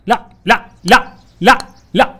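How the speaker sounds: high, loud panicked cries. Real, not acted. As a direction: shout no as you fall. Panicking as the character falls. (صرخة هلع عالية)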